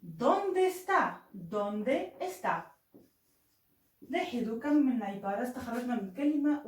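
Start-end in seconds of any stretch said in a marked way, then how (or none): 0.0-1.3 ¿Dónde está? drawn out very slowly, starting high on dónde and falling; slow rising demo. Shown as a demonstration. Teacher showing students the question, slow and high. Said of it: "كيف أقول أين هو؟". 1.3-2.7 slow plain repeat. Shown as a demonstration. Teacher repeating the question for students, slow and level. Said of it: "كيف أقول أين هو؟".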